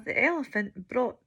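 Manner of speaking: ordinary delivery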